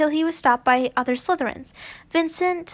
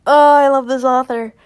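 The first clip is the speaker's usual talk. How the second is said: much louder, gushing delight; 9 dB louder than usual. Real, not acted. Reader praising the fic author, loudly delighted. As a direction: shout your love for the writer.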